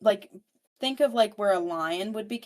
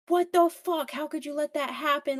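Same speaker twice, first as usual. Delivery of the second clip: indignant scolding; 7 semitones higher than usual. Retold or imitated. Becky scolds her brother, high indignant rebuke. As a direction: chew him out, disbelieving.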